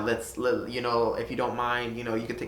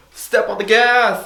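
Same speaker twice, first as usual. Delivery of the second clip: a sudden excited yell, much higher and louder; mock hype; 10 semitones higher, 10 dB louder than usual. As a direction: slowly fire yourself up and shout it. Self-quote deciding to speed.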